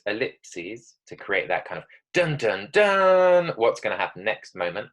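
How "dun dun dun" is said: mock-ominous, last note held high and loud; dramatic suspense sting. Shown as a demonstration. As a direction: build to a big drawn-out cliffhanger note.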